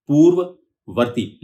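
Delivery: पूर्ववर्ती said slowly, both व sounds clear; slow, careful. Shown as a demonstration. Teacher slowly sounding out a tricky word. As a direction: draw out पूर्ववर्ती, keep the व sound flowing. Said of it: "व जो है आपस में जुड़ा हुआ है".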